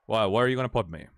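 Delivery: normal talking voice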